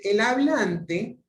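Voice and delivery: slow, high reading-out voice; reading aloud. Shown as a demonstration. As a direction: read the definition aloud, slowly.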